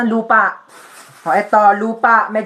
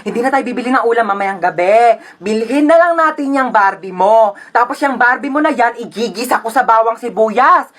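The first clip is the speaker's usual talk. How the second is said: exasperated, emphatic threatening rant; fed-up mother. Acted. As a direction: scold with mounting sarcasm. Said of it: "Tumataas yung high blood ko sa'yo". (galit na nanay)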